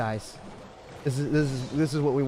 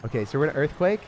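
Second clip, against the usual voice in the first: ordinary talk, nothing marked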